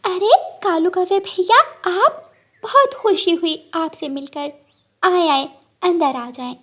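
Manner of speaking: put-on a little sparrow thrilled to see an old friend; delighted sparrow. Sparrow greets crow. (ऊँची, खुश चिड़िया की आवाज़)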